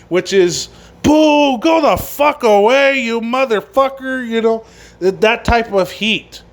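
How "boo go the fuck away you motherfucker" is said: higher, slow mock heckle; hostile crowd booing. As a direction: imitate a fan booing someone out of the building. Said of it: "motherfucker heat".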